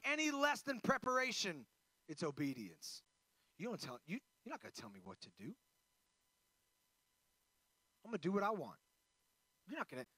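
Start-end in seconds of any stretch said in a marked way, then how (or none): 3.6-10.0 defiant rebel. Retold or imitated. Voicing a culture that resists obedience.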